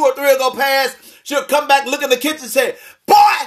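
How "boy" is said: mother's third time, raising her voice; exasperated mother yell. Retold or imitated. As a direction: yell it from the kitchen, fed up. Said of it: "notice now".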